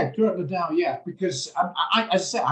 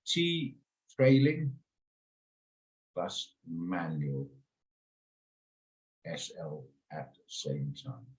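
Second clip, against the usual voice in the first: very slow, low, trailing off softer; muttering while writing; 7 semitones lower, 7 dB quieter, slower than usual. Real, not acted. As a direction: sound out a note to yourself, word by word. Saying a note while writing it.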